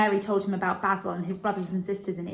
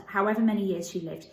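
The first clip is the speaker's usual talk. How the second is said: plain reading voice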